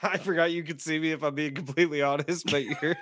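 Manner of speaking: talking through giggles; trying to speak while cracking up; laughing. Real, not acted. Cohost caught laughing explains to the host, laughing. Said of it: "why are you laughing".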